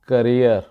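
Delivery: करियर with a short first vowel, stress on the second syllable; correct pronunciation. Shown as a demonstration. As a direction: say करियर as kuh-REER. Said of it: "बार बार सुनेंगे तो आपकी practice हो जाएगी".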